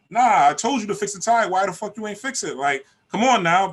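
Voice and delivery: high, loud, demanding scream; mock yelling girlfriend. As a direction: chew him out over the tire. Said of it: "barking and screaming".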